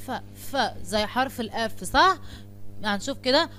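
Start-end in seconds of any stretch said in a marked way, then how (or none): 0.1-0.6 "ف، ف" as a drawn-out f sound, repeated twice; isolated f sound for children; phonics sound demo. Shown as a demonstration. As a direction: sound out "ف، ف" clearly like a phonics drill. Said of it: "بتتنطق ازاي".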